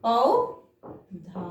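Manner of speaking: पौधा stretched very slowly with a long औ; drawn-out model word. Shown as a demonstration. Teacher modelling पौधा for children, very slowly. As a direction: linger on the औ of पौधा. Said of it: "हम क्या बोलते हैं?".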